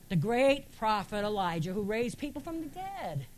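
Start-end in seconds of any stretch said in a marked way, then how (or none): none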